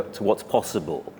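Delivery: ordinary talk, nothing marked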